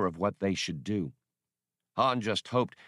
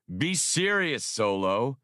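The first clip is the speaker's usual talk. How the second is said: interrupting; 5 semitones higher, slower than usual. Acted. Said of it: "Hirkin interrupted".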